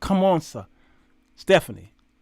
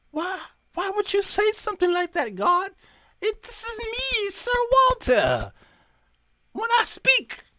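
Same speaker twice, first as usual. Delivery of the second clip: high-pitched, softer mock offended protest; mock indignation; 11 semitones higher, 4 dB quieter than usual. Preacher mocks his own proud protest to God, high. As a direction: play hurt pride, pitched way up.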